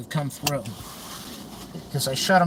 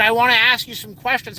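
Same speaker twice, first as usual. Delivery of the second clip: mimicked insistence; 6 semitones higher, 5 dB louder than usual. Mimics mother's insistent repeated question.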